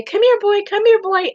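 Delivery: high sing-song call to the cat. Shown as a demonstration. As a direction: call him like a pet you love. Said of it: "All I have to do is say".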